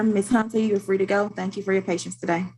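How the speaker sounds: ordinary delivery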